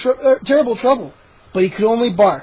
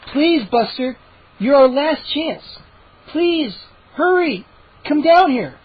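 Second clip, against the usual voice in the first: pleading; 7 semitones higher, slower than usual. A narrator's reading. Begging last chance.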